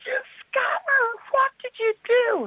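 very high, slow accusing cry; retold shout. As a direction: re-enact yelling at the scammer down the phone. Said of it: "I shouted on the phone".

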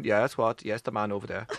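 a puzzled Finn repeating; Finnish literal reply. Retold or imitated. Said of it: "They're going".

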